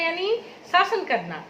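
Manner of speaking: ordinary talk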